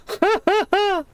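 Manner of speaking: high, bursting laugh. Real, not acted. Gamer after a heck of a shot, laughing.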